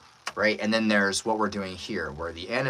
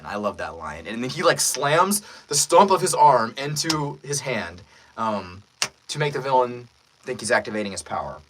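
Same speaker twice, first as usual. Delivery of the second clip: ordinary talk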